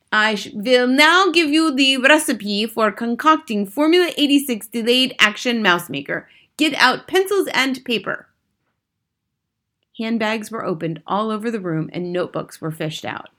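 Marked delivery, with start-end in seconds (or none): 0.1-8.3 put-on high, loud accented witch orders; shouting witch. A narrator's reading. Grand High Witch dictating her recipe, shouting orders. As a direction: declaim it imperiously in a witchy accent. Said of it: "was shouting".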